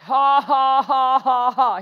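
loud, deliberate fake laughter; put-on laugh. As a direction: punch out a forced laugh. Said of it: "but I just laughed".

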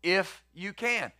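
slow, doubtful "If you can", dwelling on the words; preacher mulls over the father's doubting words slowly; slow, doubtful quote. Shown as a demonstration. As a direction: let the doubt hang in every word. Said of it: "Now, think about that".

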